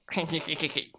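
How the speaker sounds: soft, rapid mischievous cackle; villain's snicker. A narrator's reading. Alien plans to eat every potato, sneaky soft cackle.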